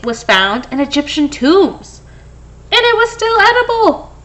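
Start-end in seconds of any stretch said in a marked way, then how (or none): 2.7-4.1 amazed. Acted. Amazed fact about honey.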